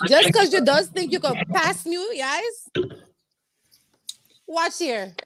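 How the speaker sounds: warning friends in a light Guyanese accent; Guyanese side. Real, not acted. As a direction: let the Guyanese accent come out on watch here. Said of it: "That's my Guyanese side coming out".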